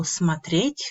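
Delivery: смотреть stressed on the final -еть; stress on last syllable. Shown as a demonstration. Teacher showing the infinitive's stress, смотреть stressed on the last syllable. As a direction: let the end of смотреть carry the stress, rising. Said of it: "on the last syllable".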